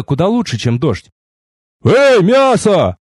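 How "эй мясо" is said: loud, high drawn-out hail; irritated shout. A narrator's reading. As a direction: call out sharply in annoyance, stretching the words. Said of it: "Раздражённый мужской оклик". (раздражённый громкий оклик)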